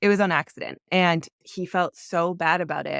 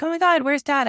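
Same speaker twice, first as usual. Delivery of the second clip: put-on high, excited kid voice asking for dad; mimicking the kids missing their dad, pitched high and eager; imitating the kids; 9 semitones higher, 3 dB louder than usual. Mother imitating her kids asking for dad, high and excited. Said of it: "they'll be like".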